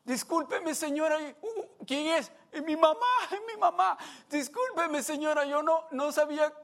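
high, loud pleading man; big man begging the angry mother's forgiveness; scared apology. Retold or imitated. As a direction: beg for pardon, frightened and high.

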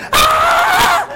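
high laughing shriek; retelling mom's scream with a laugh; mock scream. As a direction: shriek like the centipede is climbing up your leg. Mom screams at centipede.

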